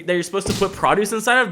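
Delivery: usual speaking voice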